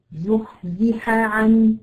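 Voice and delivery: زحزح عن said very slowly, the haa kept apart from the ain; slow, separate the ḥ and the ʿ in زحزح عن; careful haa before ain. Shown as a demonstration. The teacher isolates the words for her student. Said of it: "خلي بالك الحاء جنب العين".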